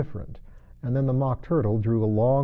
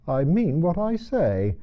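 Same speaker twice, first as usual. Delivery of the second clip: slow, huffy and higher; offended; 7 semitones higher, 3 dB louder, slower than usual. A narrator's reading. Mock Turtle answering Alice, offended. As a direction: bristle at being corrected. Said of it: "in an offended tone".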